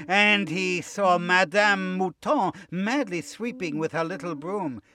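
usual speaking voice

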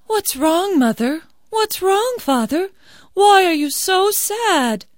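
put-on high, concerned girl's voice; bright, worried young girl; young daughter. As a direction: ask gently what's wrong.

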